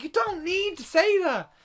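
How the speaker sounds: fed up, snap it at the film; exasperated outburst. Retold or imitated. Complaining about the film's over-explaining, exasperated yell. Reacting to film dialogue in cinema.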